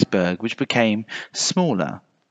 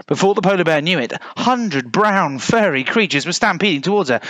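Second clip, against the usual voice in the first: plain reading voice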